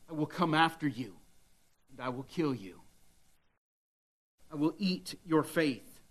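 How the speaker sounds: hushed, menacing devil voice, not a roar; low threat spoken close and quiet; devil's threat. Acted. Preacher voicing the devil threatening believers, quiet menace. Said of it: "the devil is roaring".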